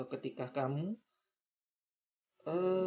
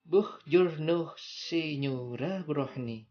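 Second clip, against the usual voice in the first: Italian accent; 3 semitones higher, 5 dB louder than usual. Shown as a demonstration. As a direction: sing it out Italian style. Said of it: "Nah, seperti ini".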